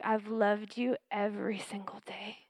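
hushed, unvoiced whisper of love. God whispering love to Amy, soft whisper. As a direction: breathe it like a secret. God speaking tenderly in memory.

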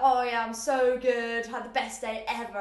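loud, drawn-out gushing. Shown as a demonstration. Mocking what a British person would never say, loud and slow. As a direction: oversell how great your day was. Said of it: "I wouldn't be like".

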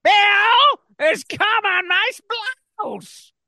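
high falsetto. Retold or imitated. Mock Hillary line repeated.

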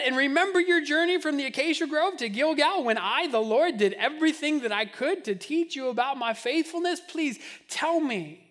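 loud, high, urgent appeal; God pleading. Acted. God pleads with Israel, loud and urgent. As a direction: answer me, God demands of his people. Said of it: "But here God says".

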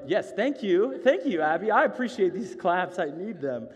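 loud, high, delighted thank-you; exuberant thanks. Real, not acted. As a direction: call it out high and loud over the applause. Thanking audience for their clapping.

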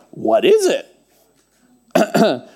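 slow, drawn-out bewildered question; puzzled Israelites. Acted. Israelites staring at strange flakes, slow puzzled question. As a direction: stretch it out, baffled by what they see. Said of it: "they said to one another".

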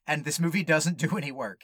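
ordinary delivery

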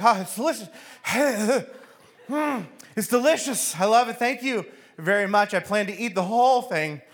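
high, strained politeness with a burning mouth; talking through pain. Acted. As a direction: pretend it's delicious while suffering. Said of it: "trying to talk through the pain of it".

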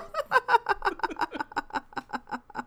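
long high giggle fit; long laugh. Real, not acted. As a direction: dissolve into giggles. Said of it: "long laugh".

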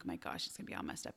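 hushed, rushed panicked whisper. Retold or imitated. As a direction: whisper it fast, like a private panic. Panicked inner thought after crash.

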